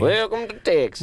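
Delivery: high-pitched mock Texan welcome; exaggerated Texan accent. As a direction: ham up a Texas greeting. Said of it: "and then Texas".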